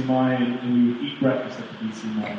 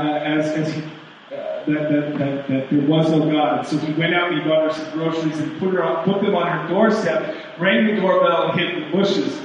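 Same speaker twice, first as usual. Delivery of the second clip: usual speaking voice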